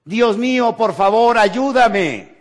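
high, loud plea to God; desperate cry for help. Acted. Hanging man shouting up to God for help, loud and high. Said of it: "Y entonces gritó".

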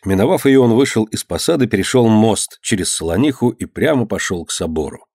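ordinary delivery

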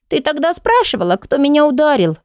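put-on high child voice; little girl voice. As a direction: play a small girl.

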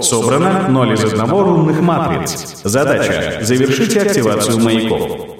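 even interface announcer read; game system voice. Acted. Quest system messages read out.

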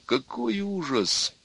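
high, soft, slow moan.

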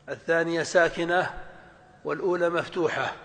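normal talking voice

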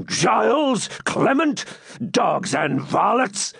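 high, slow, raging call to his men; furious outburst. Acted. Furious baron yelling at his servants, raging shout. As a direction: roar at the servants who blundered. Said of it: "exclaimed the furious Norman".

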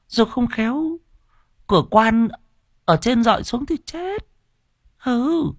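high, fussing wife pressing her husband; scheming wife. Acted.

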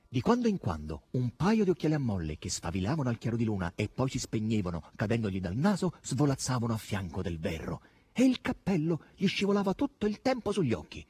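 usual speaking voice

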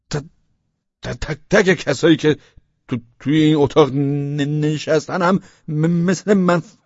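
loud, high, slow stammer, stretching blocked consonants; stuttering boy. A narrator's reading. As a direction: fight through every stuck sound with fervour. (پسر لکنتی با صدای بلند و کشیده)

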